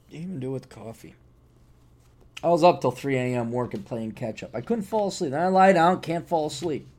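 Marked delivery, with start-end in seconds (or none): 0.1-1.2 soft, quick aside to oneself; muttered repeat. Real, not acted. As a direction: drop the voice and mumble it quickly.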